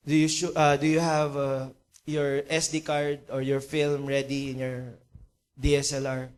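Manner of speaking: low, hesitant questioning; subdued, halting acted businessman; sad, low expectations. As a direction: check carefully, with little hope. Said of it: "malungkot na malungkot, dahan-dahan, without much expectation".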